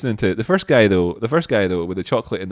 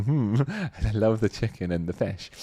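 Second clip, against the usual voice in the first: cat voice; 6 dB quieter than usual. Pretend. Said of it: "a cat sat there going".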